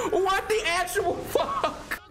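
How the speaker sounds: very high, wheezing laugh-cry; helpless laughter. Real, not acted. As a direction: lose it completely while asking. Laughing crying at the scene.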